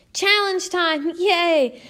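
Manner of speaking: high, slow, cheery challenge call; rally the class with a bright yay; excited announcement. Acted. Teacher hyping her students for a challenge, excited cheer.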